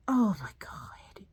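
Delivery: slow exasperated whisper. Real, not acted. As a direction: breathe it out in disbelief.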